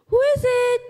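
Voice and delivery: high loud yell. Acted. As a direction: shout it from inside. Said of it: "yelled the lazy little pig".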